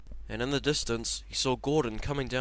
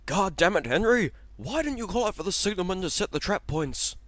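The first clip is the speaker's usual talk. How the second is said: much higher, louder angry scolding; angry shout; 10 semitones higher, 8 dB louder than usual. Acted. Gordon scolding Henry after the crash, angry shout. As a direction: tear into Henry.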